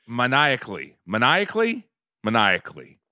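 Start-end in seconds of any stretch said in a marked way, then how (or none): 1.1-1.9 asks it as a question, pitch rising; questioning rise. Shown as a demonstration. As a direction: tries the word Maniacally? with a rising tone. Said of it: "Laughing maniac-, maniacally".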